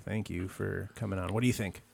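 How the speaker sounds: normal talking voice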